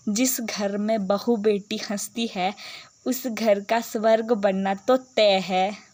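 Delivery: ordinary talk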